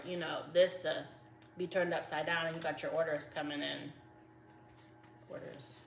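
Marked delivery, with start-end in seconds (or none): 5.3-5.7 muttered word. Real, not acted. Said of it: "I don't know why I said that".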